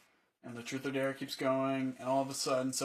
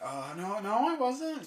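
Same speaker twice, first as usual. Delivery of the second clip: squeaky, much higher flustered denial; 8 semitones higher, slower than usual. Retold or imitated. Caught mocking her, pitch jumps high in flustered denial. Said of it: "And I was just like".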